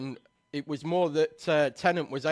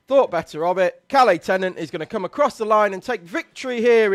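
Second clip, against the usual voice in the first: louder, rising finish call; excited commentary; 3 semitones higher, 7 dB louder than usual. Real, not acted.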